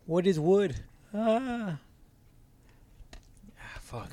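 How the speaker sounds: strained pained voice from brain freeze. Real, not acted. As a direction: groan through the cold ache. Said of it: "brain freeze".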